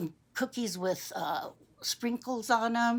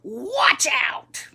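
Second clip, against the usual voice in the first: loud, high, rasped warning; grouchy old man; 6 semitones higher, 7 dB louder than usual. Acted. Old man bumping into the boy, cross. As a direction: growl out a sudden warning. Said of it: "the old man says".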